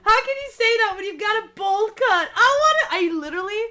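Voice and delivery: loud, high-pitched outburst; mock outrage. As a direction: yell it in shrieking disbelief. Outraged mock outburst at game character.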